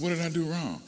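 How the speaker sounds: put-on high, pleading young man; hurt young man protesting he did nothing wrong. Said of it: "He said".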